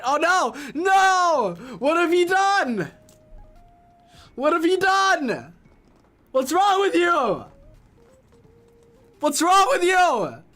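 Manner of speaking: high, loud, slow melodramatic wail; hammed-up horrified outcry at the news; dramatic mock horror. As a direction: wail it like a stage tragedy.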